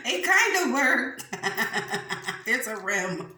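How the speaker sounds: high, loud words through real laughter; laughing hard. Real, not acted. Telling viewers the egg trick worked, laughing hard. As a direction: crack up while talking, voice jumping up.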